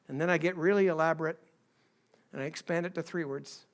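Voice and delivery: normal talking voice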